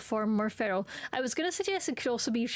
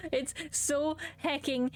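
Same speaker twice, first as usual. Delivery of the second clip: slow, gasping adoration; overwhelmed gushing; 3 semitones higher, much slower than usual. Real, not acted. Player melts over friendly Boston terrier, slow gasping gush. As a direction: gasp and draw out every word.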